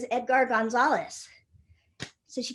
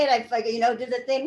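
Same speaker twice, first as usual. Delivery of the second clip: ordinary talk, nothing marked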